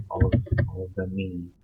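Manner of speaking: ordinary talk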